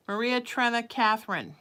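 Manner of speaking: loud call of names. Real, not acted. Calling the girls over, loud call. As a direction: call them in from afar.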